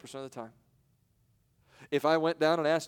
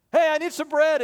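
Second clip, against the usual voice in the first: much higher, loud "Hey" up front; desperate call; 11 semitones higher, 8 dB louder than usual. Acted. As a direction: cry out for bread urgently. Friend calls out desperately.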